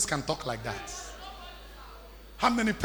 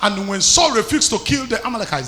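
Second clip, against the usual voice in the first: loud, forceful sermon delivery; raised-voice preacher driving the point home; impassioned preaching; 4 semitones higher, 11 dB louder than usual. Real, not acted. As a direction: preach it boldly to the congregation. Preaching to congregation with rising force.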